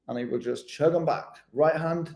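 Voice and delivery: ordinary talk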